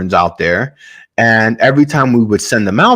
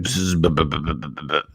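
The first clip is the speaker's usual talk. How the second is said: low, soft stuttering motor noise, fading out; imitated sputtering engine; 5 semitones lower, 9 dB quieter, faster than usual. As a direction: make an engine sputter and die away. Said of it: "his engine stops to work".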